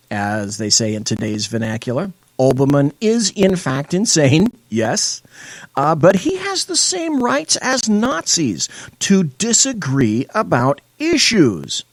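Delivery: ordinary talk, nothing marked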